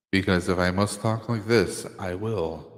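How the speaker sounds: low, weary voice of an ancient being; ancient weary immortal. Pretend. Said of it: "Do I have to use my immortal voice?".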